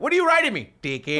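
put-on high, loud Indian-accented back-and-forth. Quizzing each other about the ticket, Indian accent. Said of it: "the guy that writes tickets is an Indian".

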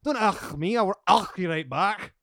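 high, loud playful telling-off; mock indignant. Host scolding guest for saying ugh, mock indignant. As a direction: throw the disgust back, mock offended.

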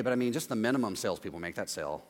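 low, quick, half-hearted sneer; grudging put-down. Retold or imitated. Jealous fool shrugging off your big sale, low and quick. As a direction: shrug off the sale as nothing special. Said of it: "They're like".

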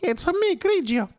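high cartoon villain voice; squeaky game villain echo; Mario villain voice. Echoes the villain voice.

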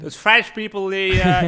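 high, loud mock American voice. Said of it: "I do a French accent".

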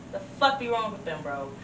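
normal talking voice

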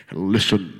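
booming, very low voice of God; big deep movie-star God voice; Sean Connery. Acted. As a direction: boom it out deep and loud. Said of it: "come down in Sean Connery's voice".

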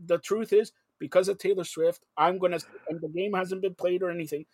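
ordinary talk

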